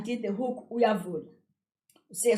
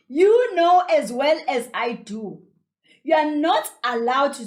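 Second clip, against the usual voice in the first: angry scolding; 4 semitones higher, 6 dB louder than usual. A narrator's reading. Angry aunt reminds Kezia of the rule, stern scolding. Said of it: "She is very angry".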